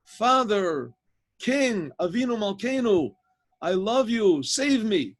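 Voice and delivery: high, drawn-out plea to God; impassioned imploring cry, short phrases with pauses; acted crying out. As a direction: call out fervently, pitch held well up.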